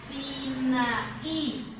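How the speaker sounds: Sinaí pulled apart into syllables, final Í stressed; syllable-by-syllable Sinaí for a class. Shown as a demonstration. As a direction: stretch Sinaí out and lean on the last Í. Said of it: "ahí está".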